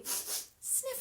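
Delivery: quick sniff; sniffing badger; sniffing noise. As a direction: sniff the air.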